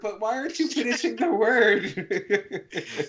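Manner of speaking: high, slow, laughing protest; incredulous question through laughter; laughing mock scolding. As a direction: scold them in a high voice, cracking up. Mock scolding the films' expert character.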